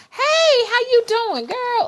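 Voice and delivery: put-on very high, bright hello girl; mock girly greeting. Greeting a girlfriend in a squeaky high voice.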